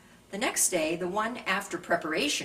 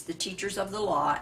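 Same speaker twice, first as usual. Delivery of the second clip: usual speaking voice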